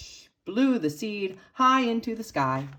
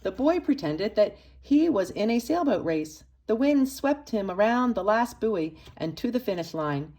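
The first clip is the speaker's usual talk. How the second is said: usual speaking voice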